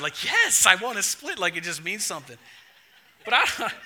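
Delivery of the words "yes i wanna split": lit up. Retold or imitated. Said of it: "She gets all lit up inside".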